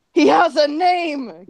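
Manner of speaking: high, loud and drawn out; excited cry. Acted. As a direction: cry out in hammed-up astonishment. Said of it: "Gaming cried".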